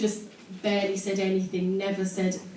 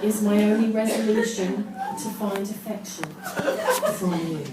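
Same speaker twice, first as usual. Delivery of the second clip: slow, mock-solemn poem recital; poem reading; 5 dB louder, slower than usual. Shown as a demonstration. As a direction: read it like a deadly serious poet. Reads own teenage poem solemnly.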